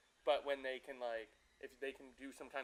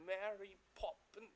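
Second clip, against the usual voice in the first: higher, softer strained British accent; British accent attempt; 6 semitones higher, 4 dB quieter, slower than usual. Shown as a demonstration. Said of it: "it's like British".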